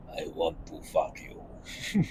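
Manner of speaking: slow, voiceless, very soft vampire rasp; Count Orlok whisper. Retold or imitated. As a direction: breathe the words out like the shadowy count. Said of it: "talks like this".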